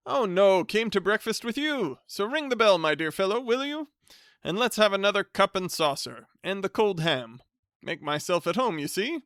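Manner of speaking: loud, high hearty greeting; top of his voice. Acted. Hearty cousin greeting the bachelor at the top of his voice. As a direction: boom it out like a jovial bore. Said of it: "spoke at the top of his voice".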